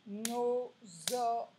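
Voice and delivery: "Dinosaure" slowly in four separate beats, di-no-sau-re; syllable split. Shown as a demonstration. Teacher counting syllables for pupils, "Dinosaure" beat by beat. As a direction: tap out each syllable of "Dinosaure", final e sounded. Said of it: "quatre syllabes".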